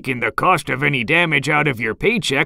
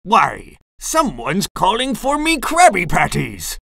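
higher-pitched surprised sea-captain exclamation; exclaiming; 7 semitones higher than usual. A narrator's reading. Crab boss woken by customers' chant, exclaiming. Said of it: "he exclaimed".

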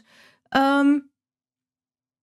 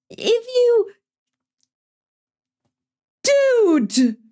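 high, exasperated outburst; real anger; 12 semitones higher, 4 dB louder, much slower than usual. Real, not acted. Streamer berating the goalie who dropped it, high angry cry. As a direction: cry out high and loud in disbelief. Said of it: "I am so fucking pissed".

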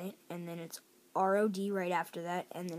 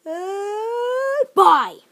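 high, loud yawn snapped short into bye; stifled yawn cut off; 19 dB louder than usual. Shown as a demonstration. Showing how to stop a yawn, a loud yawn cut short. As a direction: let the yawn start, then cut it off. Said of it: "and be all".

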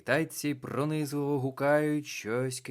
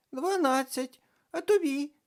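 put-on much higher, louder girl voice; young girl; 8 dB louder than usual. A narrator's reading. Карнавальна баба Яга відповідає, високий дівочий голос. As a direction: sound like a child at a fair.

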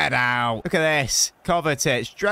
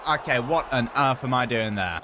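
ordinary talk, nothing marked

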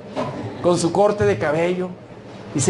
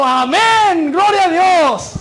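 high, loud, long-drawn holler; acted old-time brother calling out, vowels stretched; shouted reply from afar; 8 semitones higher, 6 dB louder, much slower than usual. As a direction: bellow praise back from the far corner. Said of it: "Y allá desde la otra esquina".

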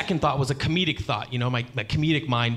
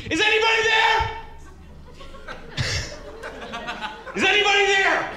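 calling out. Retold or imitated. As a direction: call out high and lost, like a blind old man. Blind old man calling out.

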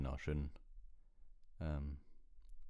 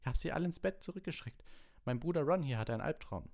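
high-pitched prefect defending himself; indignant; 12 semitones higher, 4 dB louder than usual. A narrator's reading. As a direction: justify yourself, indignant and high. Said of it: "sagte Percy empört".

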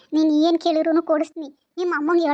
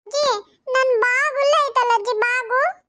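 put-on high, whiny small-boy voice; little grandson; 6 semitones higher than usual. Grandson whining to grandma for chocolate, high and whiny. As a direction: plead like a spoiled little boy.

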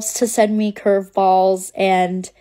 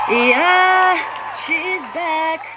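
higher, louder victory cheer; triumphant cheer; 8 semitones higher, 3 dB louder, much slower than usual. Real, not acted. Creator announcing her account is back, triumphant. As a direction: crow that she is back.

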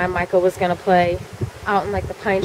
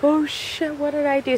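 higher, hushed panicky reaction; retold panic; 7 semitones higher, 4 dB quieter than usual. Kid discovering the mess they made, hushed panic. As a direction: reenact a quiet high-pitched oh-no moment.

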